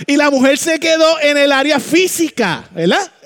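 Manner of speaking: loud, high fiery preaching; a loud sermon climax; full-force preaching. Acted. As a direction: preach it out with everything.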